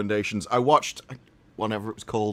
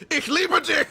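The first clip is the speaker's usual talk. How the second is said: high-pitched mock-fierce German declaration; aggressive German. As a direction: make the love line a comic German attack. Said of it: "All of German has to be aggressive".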